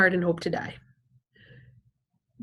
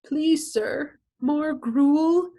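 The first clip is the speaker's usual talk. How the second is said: higher, hammed-up pleading beggar voice; begging plea; 7 semitones higher, much slower than usual. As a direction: beg for more food like a theatrical orphan, pitched up. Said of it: "he always says".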